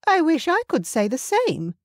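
a mother put out, cool and cutting; Justine's high voice. A narrator's reading. Justine's cool reply to Bryce.

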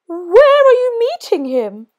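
high, loud owl question; curious owl. Acted. Owl asks the mouse, high and eager.

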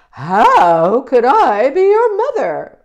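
very loud, slow, mooing cow; drawn-out, booming cow voice. Acted. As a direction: bellow it slowly like a storybook cow. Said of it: "said the cow".

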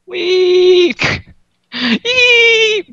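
high squeals while falling; scared giddy laughing. Real, not acted.

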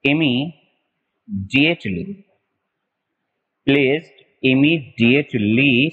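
immediately stretched out very slowly; slow dictation. Shown as a demonstration. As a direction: dictate it word by word as you write. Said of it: "जैसे मैं बता देता हूं". (बहुत धीमे बोलकर लिखवाना)

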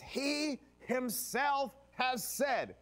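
He himself stretched out very slowly, each word weighted; double emphasis. Acted. Pastor stressing who spoke the verse, very slow emphasis. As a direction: lean hard on He himself. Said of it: "there is a double emphasis".

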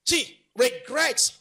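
high, driving preacher's delivery; impassioned preaching. Acted. Preacher urging people to tell themselves to improve, impassioned.

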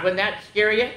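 usual speaking voice

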